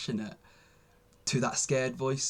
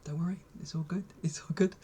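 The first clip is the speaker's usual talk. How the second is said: soft, whispered reassurance, a touch higher; soothing other voice; 5 semitones higher, 5 dB quieter than usual. Retold or imitated. His kind inner voice answering the scared one, softly reassuring. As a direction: hush the worry gently, like a kind friend. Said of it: "the other voice is like".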